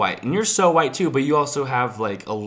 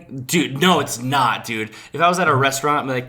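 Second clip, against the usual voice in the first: usual speaking voice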